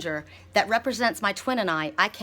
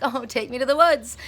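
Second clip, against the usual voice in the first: longing plea; 5 semitones higher, 5 dB louder than usual. Retold or imitated. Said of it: "And I'm like".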